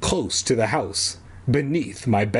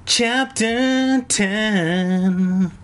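slow announcement; 8 semitones higher, much slower than usual. A narrator's reading. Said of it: "chapter heading read out".

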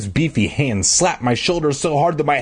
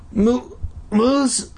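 high, choked broken gasp; wheezing; 10 semitones higher than usual. A narrator's reading. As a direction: squeeze it out with no air. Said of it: "I wheezed".

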